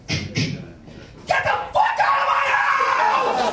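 man with a shotgun yelling at an intruder, furious shout. Retold or imitated. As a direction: roar him out of the house.

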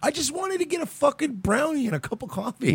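high, plaintive mock customer voice; imitating a put-out customer; whiny customer. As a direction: whine it like a bystander who only wanted coffee. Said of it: "You're like".

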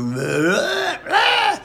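pushed high blurt leaping to a squawk; high, forced vocal squawks; imitated strained singing. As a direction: mimic a singer straining up into a yelp. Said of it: "you start to go".

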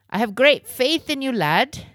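high, loud hearty praise for a lad; enthusiastic encouragement. A narrator's reading. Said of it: "clapped Rome on the back enthusiastically".

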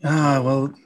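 laughing mock outrage; mock exasperation. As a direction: tease them for being wrong. Friend dislikes crepes, mock outrage.

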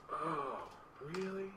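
soft, small "oh, really?"; hushed questioning reply; subdued surprise. Retold or imitated. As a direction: imitate someone suddenly taken aback, very quietly. Client's subdued reaction retold.

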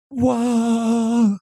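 long, high mock-dreamy whoa; exaggerated starry-eyed awe; awestruck. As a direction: hold it out high in mock wonder. Mocking a dreamy romantic expectation.

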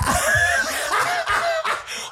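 very high, helpless laugh; crying with laughter. Real, not acted. Host losing it at the failed accent, helpless laughter. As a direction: laugh until the tears come. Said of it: "He's crying".

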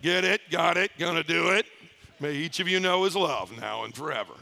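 a lightly voiced preachy mentor; half-joking imitation of a zealous churchman; mimicking the mentor. Mocking the man who gave him the book, lightly voiced. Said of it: "I love mimicking him".

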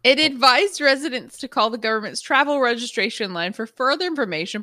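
normal talking voice

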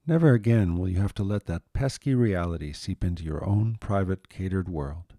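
ordinary delivery

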